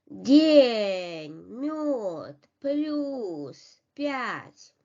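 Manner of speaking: "День, мёд, плюс пять" with palatalized consonants and long falling vowels; emphatic, drawn-out "День" then "мёд, плюс пять", all soft. Shown as a demonstration. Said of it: "the soft consonants".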